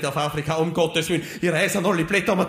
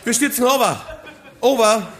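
laughing frantic radio call; over walkie talkie; 6 semitones higher than usual. Acted. Lachender Notruf über Funk. (lachender Funkspruch)

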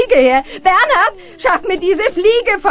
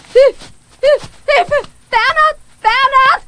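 panicked cries, rising higher; calling for help; 7 semitones higher, 4 dB louder, slower than usual. Acted. Mathilde calling Bernhard for help after a vision, alarmed.

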